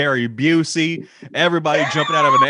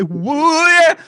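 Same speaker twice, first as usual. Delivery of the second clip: loud high whoop; excited shout; 14 semitones higher, 7 dB louder than usual. Pretend. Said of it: "What do we say?".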